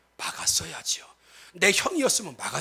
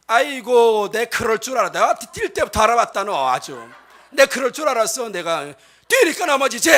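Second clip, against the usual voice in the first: higher, louder told-you-so scolding; nagging neighbour woman; 4 semitones higher, 8 dB louder than usual. Retold or imitated. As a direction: scold like the smug woman next door. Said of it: "이건 옆집 아줌마야".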